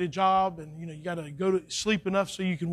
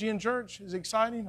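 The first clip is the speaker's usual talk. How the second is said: usual speaking voice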